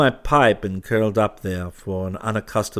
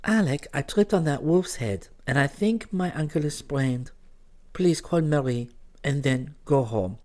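put-on lighter, higher young woman's voice; natural voice; 6 semitones higher, 3 dB quieter than usual. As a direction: speak naturally, a little softer. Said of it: "said in her natural voice".

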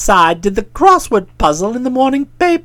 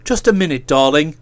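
low soothing male voice; 11 semitones lower than usual. Acted. Boko heads off his fiancée.

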